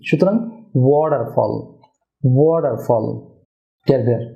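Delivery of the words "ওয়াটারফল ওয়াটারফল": "ওয়াটারফল" as WO-dar-fol, D for T; correct pronunciation. Shown as a demonstration. Teacher models the correction, "ওয়াটারফল" as WO-dar-fol. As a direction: round the "wo" and soften T in "ওয়াটারফল". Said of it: "টি এর উচ্চারণটা হয়".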